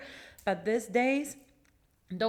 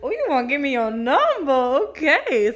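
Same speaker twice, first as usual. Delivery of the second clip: excited; 4 semitones higher, 10 dB louder than usual. Retold or imitated. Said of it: "I was like".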